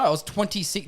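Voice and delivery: normal talking voice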